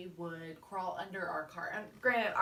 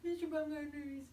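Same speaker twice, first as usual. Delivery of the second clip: imitating daughter; 6 semitones higher, 4 dB quieter than usual. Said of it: "she'd be like".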